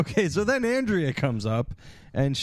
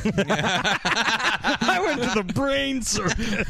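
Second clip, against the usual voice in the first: higher, slow, drawn-out boast; mock proud boast; 6 semitones higher, much slower than usual. Joking the character calls Yale the brain circus, slow and higher. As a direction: stretch it out like a grand claim. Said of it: "He's like".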